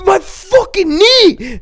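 high, stretched-out mock wail; hammed-up panicked yell; imitating hysterical friend. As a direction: howl it slowly in mock agony. Hysterical friend retold on phone to mom.

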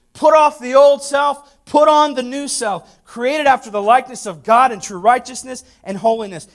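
high, loud scripture proclamation; full-force preaching. Real, not acted. Preacher proclaiming the new self to the church, full-force preaching. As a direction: ring each phrase out from the pulpit.

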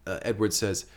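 ordinary delivery

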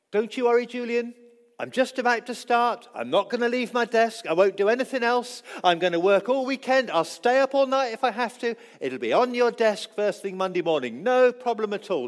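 lightly voiced charmer promising the earth; smooth-talking coworker. A coworker reassuring the boss, breezy and over-confident. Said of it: "an Irish guy once who had the gift of the gab".

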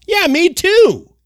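high, loud and slow; excited agreement. Real, not acted. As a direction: gush in agreement. Answering imagined listener.

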